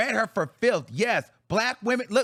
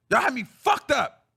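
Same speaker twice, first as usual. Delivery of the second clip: ordinary talk, nothing marked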